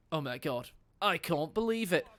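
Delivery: ordinary talk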